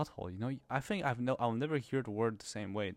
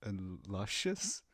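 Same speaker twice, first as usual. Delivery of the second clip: slow mocking quote; slower than usual. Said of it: "did you just say".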